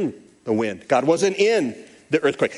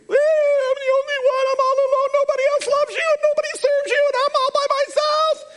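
high, crying whine of a self-pitying prophet; high tearful whine, sorry for himself; whiny Elijah; faster than usual. Retold or imitated. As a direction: play Elijah sobbing that he's all alone. Elijah complains to God again.

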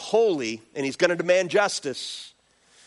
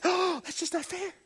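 mock whine; 11 semitones higher, 8 dB quieter than usual. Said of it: "Some of you get, have a hard time with that".

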